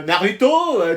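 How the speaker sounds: normal talking voice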